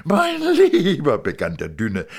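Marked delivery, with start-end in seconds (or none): 0.0-1.2 much higher, louder, slow astonished joy; thin man amazed to see his old friend; a high, gushing thin man; delighted thin man. Acted. Thin man after kissing.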